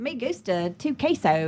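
put-on slow Spanish in a Southern American accent; George W. Bush. Trading bad Spanish lines with a fellow comic, Southern-accented. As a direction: butcher the Spanish with a leisurely twang. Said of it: "we're just doing George W. Bush".

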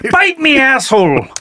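a pirate with an Irish lilt, hammed up. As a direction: play it like a kinky Irish pirate. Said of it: "That was like an Irishman".

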